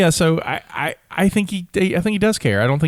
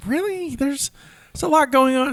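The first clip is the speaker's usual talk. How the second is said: high-pitched mock incredulity; retold disbelief; 11 semitones higher than usual. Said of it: "And I was like".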